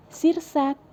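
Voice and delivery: ordinary delivery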